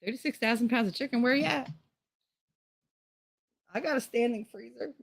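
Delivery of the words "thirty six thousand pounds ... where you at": higher, fast, softer hawking call; quick mock sales pitch. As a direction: tease it out like a street seller. Calling out for buyers.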